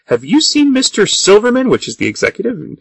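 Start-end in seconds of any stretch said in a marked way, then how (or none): 0.1-1.7 high, sing-song mock politeness; prissy Clay voice. Clay asking the assistant about the executive, high and sugary. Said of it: "Mister Clay says".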